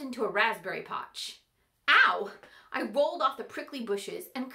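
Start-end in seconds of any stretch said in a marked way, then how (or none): 1.9-2.3 a short loud yelp; sharp yelp of pain. A narrator's reading. Fairy yelps when the clog hits her nose.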